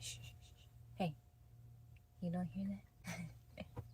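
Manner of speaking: slow, quiet half-whisper; hushed and slow. Pretend. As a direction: draw it out softly, hushed. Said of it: "tell me your voices".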